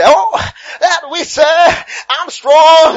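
high, loud, drawn-out wailing cry; hammed-up fervour, stretched out; mock fervent believer. Mimicking believers who misuse the text, loud mock fervour.

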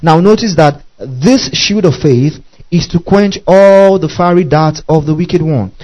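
ordinary delivery

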